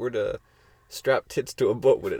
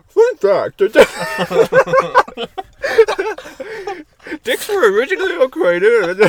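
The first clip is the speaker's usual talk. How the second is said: laughing through a mock fun fact; giggling, words half laughed; 9 dB louder, slower than usual.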